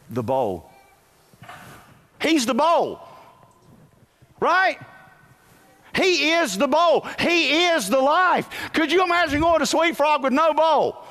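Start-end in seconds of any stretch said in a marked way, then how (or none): 2.2-11.1 high, loud preacher's hammering; full-force preaching. Acted. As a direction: drive the point home to the church.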